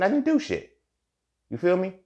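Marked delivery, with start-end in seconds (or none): 0.0-0.8 much higher, punched denial; indignant retold protest; emphatic denial. Man protesting his innocence to his partner.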